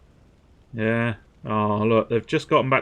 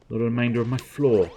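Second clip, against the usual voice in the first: ordinary talk, nothing marked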